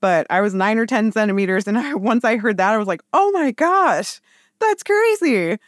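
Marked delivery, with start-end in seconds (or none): 3.1-5.6 high, slow amazed gasp; surprised. Retold or imitated. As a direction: marvel at the news, way up high. Reacts to being fully dilated.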